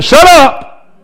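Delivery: loud, high shout; acted angry bystander's shout; crowd silencing him. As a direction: yell it to silence someone. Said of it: "The same people who said".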